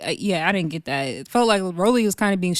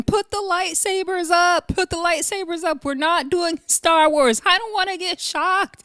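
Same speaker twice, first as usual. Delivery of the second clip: mock scream; 10 semitones higher than usual. Retelling Russian Cream on the bus, hammed-up high scream. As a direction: play up her panic in a high pitch. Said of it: "Russian cream is screaming".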